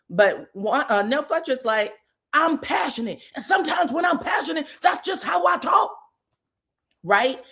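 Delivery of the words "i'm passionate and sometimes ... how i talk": a hint of a shouty, passionate woman; mock-passionate, rising into a shout; crazy voice. Nell explaining herself to Wanda, lightly mock shouty. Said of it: "both of them got crazy voices".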